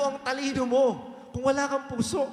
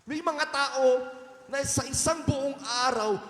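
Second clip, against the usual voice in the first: normal talking voice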